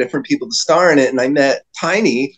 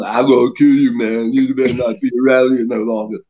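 mock threat; 3 semitones lower than usual. Retelling a big man's threat.

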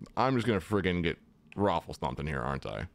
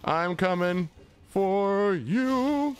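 excited high; 9 semitones higher, 4 dB louder, slower than usual. Acted. Gamer greets enemy dog playfully.

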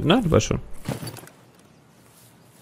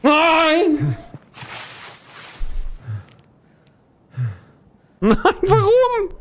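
anguished cry; 13 semitones higher, much slower than usual. Real, not acted. Death in game, despair.

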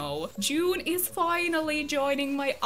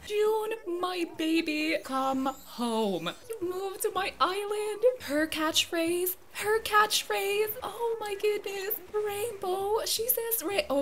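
high, choked-up cooing; adoring joy; 7 semitones higher than usual. Real, not acted. Gamer welcoming June to the island, adoring and overjoyed. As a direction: welcome a long-lost darling with tearful delight. Welcoming the dream villager home.

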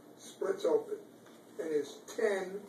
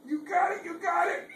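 excited cheer; 13 semitones higher than usual. Real, not acted. Cheering child pressing button.

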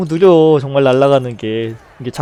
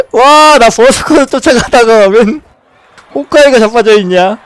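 excited; 5 semitones higher, 9 dB louder than usual. Real, not acted. After the kill.

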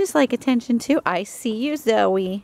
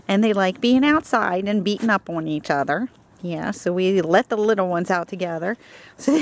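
ordinary talk, nothing marked

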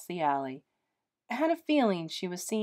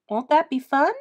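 put-on high, louder, bright-eyed sell to a child; put-on enthusiasm; 6 semitones higher, 8 dB louder than usual. A narrator's reading. Mother cheerfully selling the move to her little girl. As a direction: oversell the news like a kids' show host. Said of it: "infusing her voice with enthusiasm".

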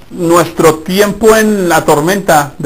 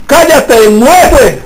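higher, louder command to the storm; commanding rebuke; 6 semitones higher, 3 dB louder, much slower than usual. Acted. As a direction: order the wind to be still. Jesus rebukes the storm, quoted.

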